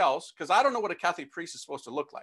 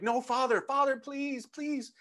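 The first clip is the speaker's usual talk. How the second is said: imitated pleading; 7 semitones higher, slower than usual. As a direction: plead like a desperate mom on the phone. Mother begs priest on phone.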